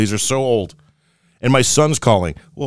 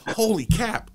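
high, soft deaf-guy voice; a lightly voiced old friend, soft and high; deaf guy's voice; 7 semitones higher, 6 dB quieter than usual. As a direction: play the deaf friend pitched up. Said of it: "They said".

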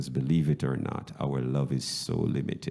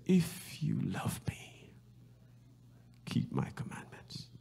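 soft whispered words of Jesus; 10 semitones higher, 7 dB quieter than usual. Acted. Pastor quoting Jesus to the church in a whisper. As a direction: drop to a hush after the shouting.

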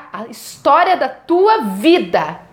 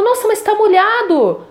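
higher, slow, astonished mother; a touch of awed surprise, drawn out; amazed mother; 9 semitones higher, slower than usual. Retold or imitated. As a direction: mildly imitate mom's wonder at the wet, cold leaf. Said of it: "Minha mãe ficou maravilhada".

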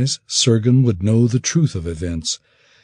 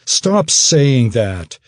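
angry plea; 4 semitones higher, 5 dB louder, much slower than usual. Acted. Sergen defends father, tearful.

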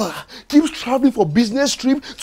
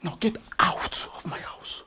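whispered, much softer order; menacing whisper; 6 semitones lower, 8 dB quieter than usual. Acted. Husband ordering wife out of the house, whispered menace. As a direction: hiss the order out cold.

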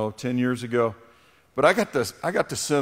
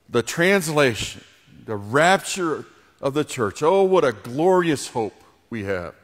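heartfelt prayer. Acted. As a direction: pray aloud, lingering on the hope. Pastor leads closing prayer.

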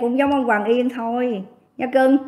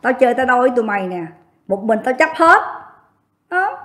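ordinary delivery

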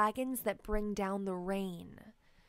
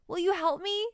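put-on hopeful child asking; 9 semitones higher, 5 dB louder, faster than usual. A narrator's reading. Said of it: "she asks".

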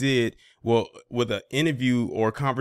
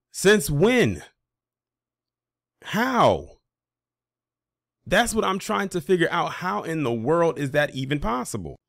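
incredulous; 6 semitones higher than usual. Real, not acted. Outraged disbelief at the clip.

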